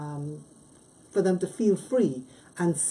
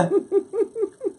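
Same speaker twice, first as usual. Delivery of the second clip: sudden high laugh, amused; high burst of laughter; 4 dB louder than usual. Real, not acted. Host cracking up at the guest's jab, laughing.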